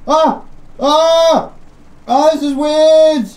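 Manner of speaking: high, loud startled cries; startled yelps. Real, not acted. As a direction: caught off guard by the drop. Falling, startled.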